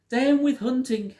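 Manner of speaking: hooted high and loud like a little dinosaur. A narrator's reading. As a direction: call it out as a happy hoot. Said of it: "he hooted".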